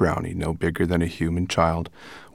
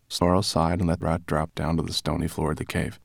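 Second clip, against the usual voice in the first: ordinary delivery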